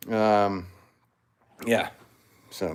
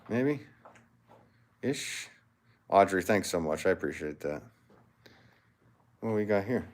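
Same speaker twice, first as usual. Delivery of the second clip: normal talking voice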